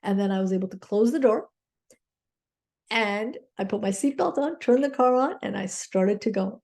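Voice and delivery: ordinary delivery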